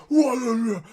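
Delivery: high startled yelp; imitating a startled cry; a sudden high, loud whoa; mock shout. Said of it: "Van Dijk turns round and goes".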